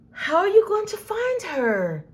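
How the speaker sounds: put-on a little boy asks the zookeeper, anxious and high; little boy asking. A narrator's reading. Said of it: "asked one little boy".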